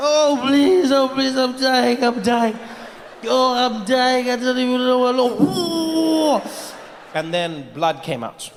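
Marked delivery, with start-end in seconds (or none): 0.1-6.4 high, slow dying moans; fake heart attack gasp. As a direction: plead as if dying, hammed up. Acting out dying.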